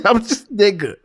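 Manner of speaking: high-pitched words breaking into laughter; too tickled to finish the sentence; real laugh bubbling through the words. Real, not acted.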